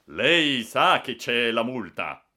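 loud, slow official challenge; stern warning. A narrator's reading. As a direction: spell out the penalty forcefully. Railway staff warn of a fine.

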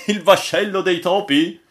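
high, loud cry of surprise; exclaimed question. A narrator's reading. Crew reacting to the posted title, exclaiming.

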